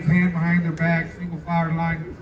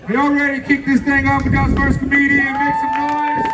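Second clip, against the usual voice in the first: loud, raised crowd-rousing call; hype emcee; 6 semitones higher, 3 dB louder than usual. Real, not acted. Host kicks off the show for the crowd, rousing shout. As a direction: whip the audience up, high and loud.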